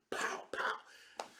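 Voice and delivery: soft, voiceless "pow, pow"; hushed mimicry of blows landing; imitated paddle smacks. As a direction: whisper out the sound of two whacks.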